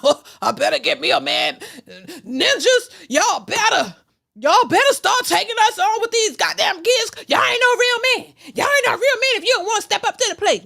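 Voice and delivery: shrill-voiced parody of women begging men to step up; mocking desperate women. Voicing desperate women demanding men.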